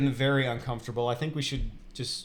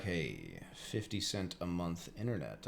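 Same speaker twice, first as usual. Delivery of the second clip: low, soft mock salesman pitch; 8 semitones lower, 13 dB quieter, slower than usual. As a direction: pitch the cheap deal in a hushed low voice. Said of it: "he was like".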